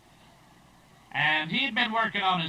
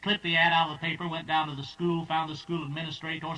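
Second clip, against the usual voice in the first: ordinary delivery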